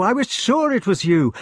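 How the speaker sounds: high, exultant exclamation; excited recognition. A narrator's reading. Knight recognising his long-lost friend, elated. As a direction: burst out high with joy.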